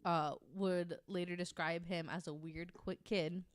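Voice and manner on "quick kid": normal talking voice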